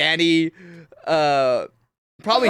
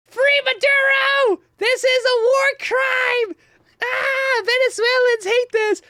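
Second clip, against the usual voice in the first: shrill, much higher mock outrage, stretched out slowly; mocking liberal protesters; slower than usual. Streamer mocks liberal protesters to chat in a high mock wail. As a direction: ham up a whiny protester's wail. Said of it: "they're all like".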